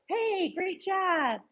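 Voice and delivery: soothing a distracted dog with slow, high praise. Real, not acted. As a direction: stretch every word to calm the dog. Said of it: "Slow myself down".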